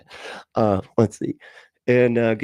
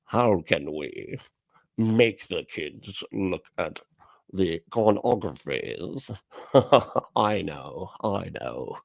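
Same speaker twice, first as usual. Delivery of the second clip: lower, slow scheming villain voice; cartoon villain; 4 semitones lower, 4 dB quieter, slower than usual. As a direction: plot like a bond villain in a spinning chair. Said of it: "picturing some guy in a spinny chair going like this".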